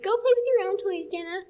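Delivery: high, loud, rushed mock scolding; imitated annoyed sister. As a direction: mimic a sister shooing off the little one. Imitates sister sending her away.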